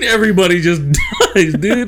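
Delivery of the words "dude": ordinary talk, nothing marked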